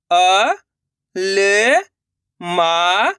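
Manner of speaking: अ, ल, मा each separate, long and rising; letter-by-letter demo. Shown as a demonstration. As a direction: sound out अ, ल, मा with pauses. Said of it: "एक अक्षर का उच्चारण सही से". (अ, ल, मा अलग-अलग खींचकर)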